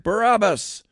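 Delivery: high, loud shouted answer; crowd shout. A narrator's reading. Crowd answering the governor, shouting back. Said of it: "The crowd shouted back".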